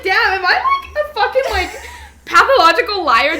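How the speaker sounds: high, loud laughing quote; laughing self-mockery. Mocking her own journal to her friend, high and laughing. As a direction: laugh at yourself mid-question. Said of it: "Sometimes I'm like".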